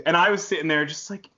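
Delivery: ordinary delivery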